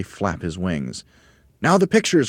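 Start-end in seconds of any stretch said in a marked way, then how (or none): 1.6-2.3 high, loud, urgent call; Mugglewump's cry. A narrator's reading. Mugglewump orders the birds to flip the pictures, crying out. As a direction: call out the orders to your helpers. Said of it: "cried Mugglewump".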